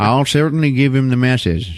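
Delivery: lightly voiced cop; a hint of a put-on cop voice; other cop. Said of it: "some other cop is like".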